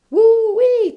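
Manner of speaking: a high, loud whooping cry; gleeful whoop. Real, not acted. Bragging to listeners about her transformation, gleeful whoop. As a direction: let out a celebratory holler.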